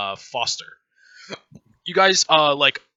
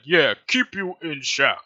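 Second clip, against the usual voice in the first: slow, louder simple brute voice; second guard creature; 2 semitones higher, 5 dB louder than usual. Acted. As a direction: echo a partner like a dim henchman. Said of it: "he's like".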